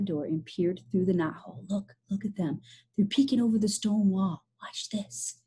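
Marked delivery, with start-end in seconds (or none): 1.7-5.4 sly hushed whisper. Acted. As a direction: whisper it conspiratorially. Peeking secretly through knothole.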